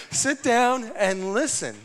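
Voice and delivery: high, loud, slow put-on command; stern God voice. Pastor voicing God's answer, slow loud command. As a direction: order them to sit, slowly. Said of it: "You said".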